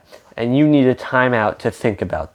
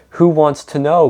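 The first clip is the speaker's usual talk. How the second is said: a mild mock growl, a bit louder; 4 semitones higher, 4 dB louder than usual. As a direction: gruffly challenge the stranger, lightly acted. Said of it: "growled a voice from inside".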